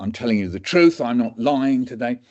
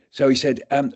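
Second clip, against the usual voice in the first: normal talking voice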